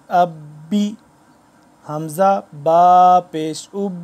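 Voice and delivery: slow, loud spelling drill with a firm hold on ب in اب and با; firm, pausing reading. Shown as a demonstration. Teacher drills students on the shadda, firm and slow. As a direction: read each syllable slowly, stopping hard on the doubled ب. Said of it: "رک بھی رہا ہوں اور تھوڑا سختی سے".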